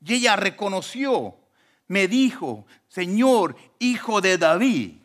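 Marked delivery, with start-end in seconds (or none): 2.9-5.0 loud, drawn-out call to the Lord; forceful acted cry. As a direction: call out in supplication, stretching the words. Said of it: "le clamaba y le decía".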